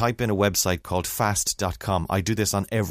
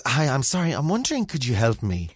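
lightly voiced polite older lady; mock polite customer voice; lady asking for help; 5 semitones higher than usual. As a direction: ask sweetly, a bit higher, like a shopper asking staff. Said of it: "the lady says to me".

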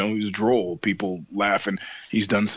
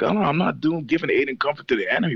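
higher-pitched, rushed denial; quick, raised-pitch indignant comeback; retold protest; 6 semitones higher, faster than usual. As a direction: act out arguing back at the boss. Retold argument with the boss.

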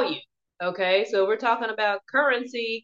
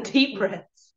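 guided meditation; 5 dB quieter than usual. Acted. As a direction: ease them gently into calm.

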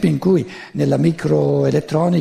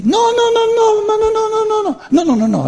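much higher, mock old lady voice piling up no; old southern ladies; 3 dB louder than usual. Narrator imitating old Sicilian women answering no, high mock squeal. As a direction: squeal a string of noes like a Calabrian granny. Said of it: "queste vecchiette della Calabria e della Sicilia".

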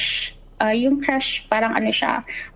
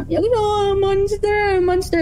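put-on crying child; 8 semitones higher than usual. Toddler crying at the nurse about the monster, high tearful wail. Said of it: "Tapos iyak siya na".

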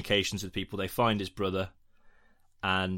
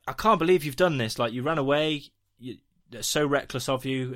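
higher, indignant telling-off; retold scolding, pitched up; mock scolding; 5 semitones higher, 4 dB louder than usual. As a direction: imitate an aunt scolding a runaway. Aunt scolding boy in retold scene.

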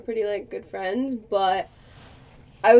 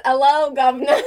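a lightly hammed Cockney greeting; a quick, jokey Cockney hello; mock Cockney; 4 dB louder than usual. To her partner, joking she'll fail: mock Cockney. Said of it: "You're gonna get an".

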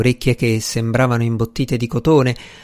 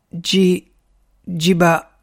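higher, halting stammer; 5 semitones higher, much slower than usual. A narrator's reading. Stunned man tries to repeat a name, stammering. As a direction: get stuck on the first syllables of the name. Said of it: "balbettò".

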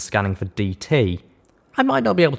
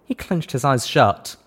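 ordinary delivery, nothing marked

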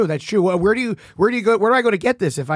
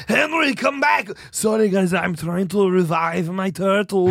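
Arnold Schwarzenegger. Acted. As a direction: drag out the words in a thick Austrian accent. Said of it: "Arnold, don't call your".

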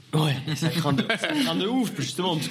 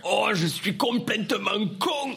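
put-on drawn-out, higher Marseille-accent idiot; mock Marseille accent; 4 semitones higher, slower than usual. Showing friends the stock idiot voice, in a mock Marseille accent. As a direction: play the big dope with a Marseille accent. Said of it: "quand tu fais l'accent du gros con, tu fais l'accent du mec de Marseille".

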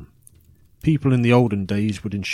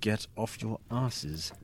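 much softer and slow, cold order; quiet menace; 9 dB quieter, much slower than usual. Acted. Storyteller coldly telling doubters to leave, soft and slow.

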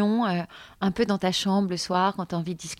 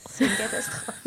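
laughing; 4 semitones higher than usual. Real, not acted. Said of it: "elle pleure de rire".

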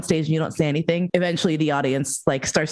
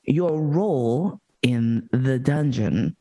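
slow outraged disbelief; 4 semitones lower, much slower than usual. Real, not acted.

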